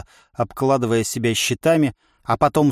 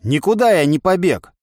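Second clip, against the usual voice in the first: mildly barked retort; 6 semitones higher, 4 dB louder than usual. A narrator's reading. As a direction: push back with a slightly raised, cross voice. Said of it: "рявкнул я". (рявкнуть, но сдержанно)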